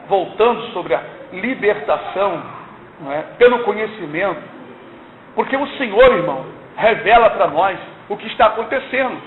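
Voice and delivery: ordinary delivery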